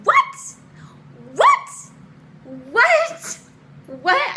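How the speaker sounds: high, loud shocked shrieks; piercingly high, incredulous what; outraged disbelief. Retold or imitated. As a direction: yell it in utter disbelief. Disbelief at boyfriend's confession.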